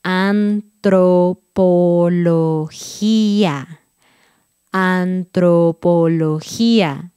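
"Antropología" stretched very slow, every syllable apart; very slow careful "Antropología"; slowly. Shown as a demonstration. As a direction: crawl through "Antropología" syllable by syllable.

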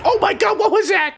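high-pitched startled outburst. Real, not acted. Gamer startled by sudden grenade, alarmed. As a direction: yelp it out in alarm.

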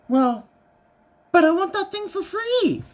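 high, petulant whine; whiny husband. Retold or imitated. Wife imitates husband whining for free item, high petulant. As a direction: sulk like a kid denied a toy.

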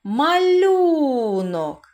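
Малюнок, very slow, лю loud and high; stress on лю. Shown as a demonstration. Teacher shows children the stress in Малюнок. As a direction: lean hard on лю in Малюнок. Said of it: "на який склад падає наголос".

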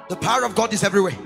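ordinary talk

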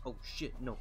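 ordinary talk, nothing marked

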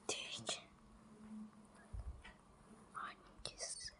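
hushed unvoiced whisper. Real, not acted. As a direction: whisper so the device can't hear. Whispering answers off camera.

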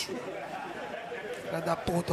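slow breathy whisper of the insult; hushed slow curse. Real, not acted. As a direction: drop to a slow whisper and spit it out. Muttered insult under breath.

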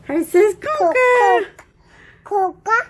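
put-on "Conca" said with a hard k instead of T, high and slow like a toddler; baby mispronunciation. Shown as a demonstration. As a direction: say "Conca" the way a one-year-old says Tonka. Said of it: "that's how a one-year-old says Tonka".

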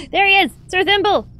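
high, loud delighted cry; excited shout. Real, not acted. Gamer spotting the bull he bought, high delighted shout. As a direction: greet him like a long-lost friend.

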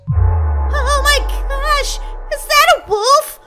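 high, drawn-out whimper; terrified. Pretend. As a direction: stretch it out in trembly pretend horror. Spots a wolf.

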